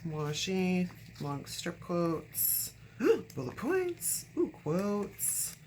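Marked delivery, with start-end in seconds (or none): 2.8-3.3 sharp excited gasp; delighted gasp. Real, not acted. Delighted at a find. 3.4-5.5 excited. Real, not acted. Delighted at a find.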